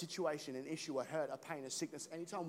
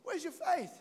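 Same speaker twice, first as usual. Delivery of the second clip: much higher, teasing; Jesus teasing a scared disciple in a much higher voice; casual Jesus; 12 semitones higher than usual. Retold or imitated. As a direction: tease the scared kid. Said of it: "And he's like".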